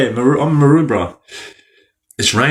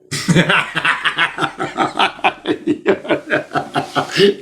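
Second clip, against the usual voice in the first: high rising burst of laughter; real laugh; 6 semitones higher, 3 dB quieter than usual. Real, not acted. Laughing after offering Beck cigarettes. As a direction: laugh at your own cheeky offer.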